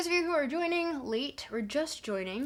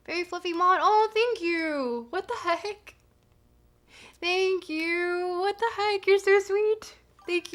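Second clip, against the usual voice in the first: excited; 6 semitones higher, 3 dB louder than usual. Real, not acted. Thanking chat for a gift.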